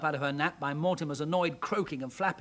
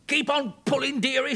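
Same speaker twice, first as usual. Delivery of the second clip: higher, louder urging shout; 9 semitones higher, 6 dB louder than usual. Acted. Father urging daughter to keep pulling, shouting. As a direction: call out to urge her on. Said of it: "shouted Mister Jones".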